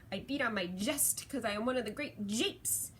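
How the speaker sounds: hammed-up gorilla boast with punched words; lightly put-on gorilla voice, playing the clown; gorilla act. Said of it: "to do his gorilla act".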